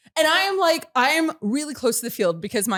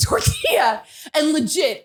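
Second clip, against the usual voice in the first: ordinary talk, nothing marked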